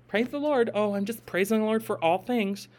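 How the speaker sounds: soft, sugary mock-pious praise; mock a fake holy believer. Mocking a dishonest believer.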